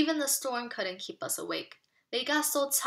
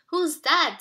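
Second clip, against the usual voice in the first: louder, higher shout over music; 6 semitones higher, 7 dB louder than usual. Acted. Dad shouts to the kids over the parade music, loud high call. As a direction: call out above the parade noise. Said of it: "Dad shouted over the music".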